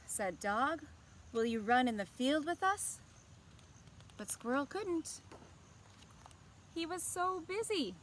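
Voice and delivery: gentle, expressive storybook reading; picture-book narration to young listeners; reading to children. A narrator's reading. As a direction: read it like story time for kids. Story narration and character line.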